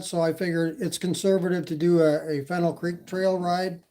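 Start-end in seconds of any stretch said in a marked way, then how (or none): none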